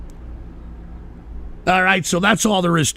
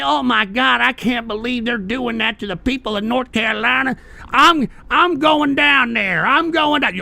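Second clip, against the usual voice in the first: higher, mock-outraged whiny quote; mocking MAGA voice; 6 semitones higher than usual. Streamer mocks MAGA volunteers rushing to North Carolina, whiny parody. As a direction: parody fake concern, hammed up.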